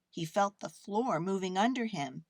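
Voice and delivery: ordinary delivery, nothing marked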